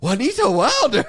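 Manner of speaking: high, wondering mock voice through a laugh; amazed gang. Gang members marvelling at the author, high and amused. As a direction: gasp the name in delight.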